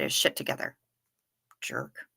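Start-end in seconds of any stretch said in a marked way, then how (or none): 1.6-2.1 breathy whispered jerk under the breath; whispered insult. Real, not acted. Insulting the squirrel under her breath, whispered.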